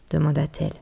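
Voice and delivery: plain reading voice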